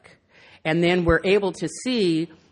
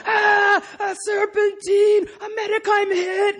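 very high, drawn-out battlefield cries; mock-agonized high yelling; little brother's war sounds; 14 semitones higher, 3 dB louder, slower than usual. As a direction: shout like a kid playing a wounded soldier. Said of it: "he'd do all the sound effects".

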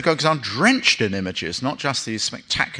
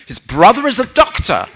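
high, loud bragging mother voice, laughing; 12 semitones higher, 7 dB louder than usual. Acted. Wife bragging to the neighbor: high, loud and laughing.

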